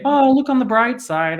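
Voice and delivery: hammed-up cheerfulness; mock chirpy cheer. Pastor mocking empty comfort to a sick friend, chirpy. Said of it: "And I don't say".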